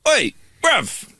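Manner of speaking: high-pitched "oi" to get attention; imitated call-out. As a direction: mimic a stranger hailing someone. Acts stranger calling out in shop.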